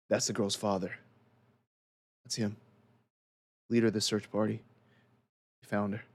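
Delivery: low, quiet aside; deputy aside. Acted. Deputy nudging Chester to whisper who the man is, low aside. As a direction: lean in and mutter the news low. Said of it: "nudges Chester on the side and says".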